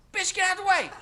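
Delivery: laughing mock yell at an old lady with a grocery cart; mock road rage yell. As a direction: bark it like a driver who's been cut off. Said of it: "say the same thing".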